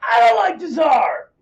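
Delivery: high, hammed-up greeting to a fellow socialist; overeager welcoming socialist. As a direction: play a gushing recruit who welcomes anyone. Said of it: "The Mensheviks were like".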